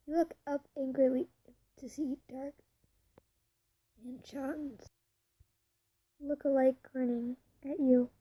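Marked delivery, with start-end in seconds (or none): none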